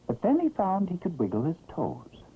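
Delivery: ordinary delivery, nothing marked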